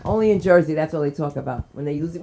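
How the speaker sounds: usual speaking voice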